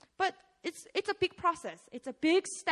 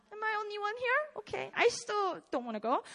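ordinary talk

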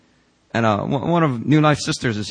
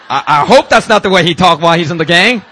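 louder and higher, laughing through the words; laughing joke; 4 semitones higher, 8 dB louder than usual. Real, not acted. Pastor joking to the congregation about Peter's gang days, laughing.

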